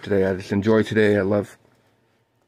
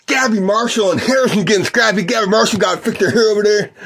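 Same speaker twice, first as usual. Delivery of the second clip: play-by-play; 10 semitones higher than usual. Acted. Calls the game for listeners.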